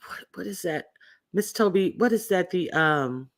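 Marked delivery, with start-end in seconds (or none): 0.1-0.9 breathy unvoiced whisper; whispered question. Real, not acted. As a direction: whisper it under your breath. Whispered, then asked aloud.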